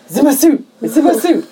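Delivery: high, mock-panicked squeal; freaking out. Man with a mosquito in his suit, hammed-up panic. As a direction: imitate someone freaking out. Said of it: "he's freaking out".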